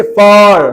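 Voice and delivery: फर with a lengthened central vowel; teacher modelling long-vowel फर. Shown as a demonstration. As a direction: hold the uh in फर long. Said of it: "थोड़ा सा long sound है ना".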